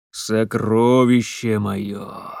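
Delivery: slow, low, coaxing call; luring call. Acted. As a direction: lure her out slowly. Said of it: "зазывал голос".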